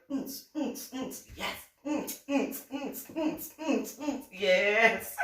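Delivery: high, rhythmic hums with gleeful yes; imitated rave beat. Imitating a raver feeling the music, rhythmic hums. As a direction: pulse out the beat, punching the last yes. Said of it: "anybody who's been to a rave is like".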